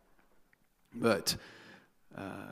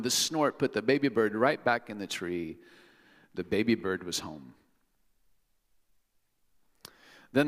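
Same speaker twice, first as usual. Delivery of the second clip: put-on reading to children. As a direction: ease it down to a tender close. Reading storybook to congregation.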